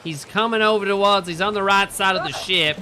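high, loud mock alarm; bad-accent impersonation. Player posing as a crewmate urging a kill, loud impression. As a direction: shout orders in a put-on character voice. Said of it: "my impression was flawless".

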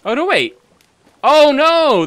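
loud gasped oh no; sudden alarmed outcry. Acted. Plot reveal reaction.

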